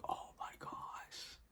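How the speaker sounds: breathless whisper, drawn out; whispered dread. Retold or imitated. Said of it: "I'm like".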